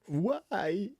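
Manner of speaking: high, drawn-out questioning why; puzzled why. Real, not acted. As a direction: stretch it out in mild bewilderment. Puzzled that the style fails.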